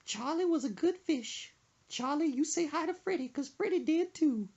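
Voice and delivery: mock-tender old lady farewell; grieving old lady. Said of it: "she looks and she goes".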